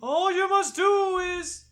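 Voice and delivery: high, loud spirit moan; high ghostly wail. Acted. Ghost voice, about to cough.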